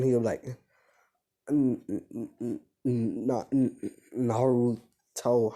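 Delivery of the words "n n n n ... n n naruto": halting, stuck on the first sound; acted stammering. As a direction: stammer your way slowly into the name. Acted stammer on seeing the eye.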